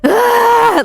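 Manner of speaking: very high imitated wail; long reenacted cry of anguish; wordless high-pitched howl of grief. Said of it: "I'm just crying and crying".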